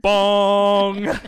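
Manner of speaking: loud booming bong like the news chimes; mock Big Ben bong. Presenter announcing the next holiday highlight with a booming mock bong.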